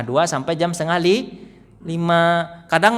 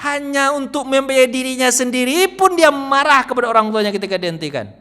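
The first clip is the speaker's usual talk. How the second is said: higher, louder rising rebuke; indignant preaching; 8 semitones higher, 4 dB louder than usual. Acted. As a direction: build the outrage step by step. (ceramah marah yang makin memuncak)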